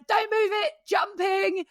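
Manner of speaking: high-pitched called-out warning, no real extra volume; imitated rider's shout. Rider coming round to the fence warns helpers, mock shouting. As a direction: mimic calling out urgently from horseback. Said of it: "so she shouted at them".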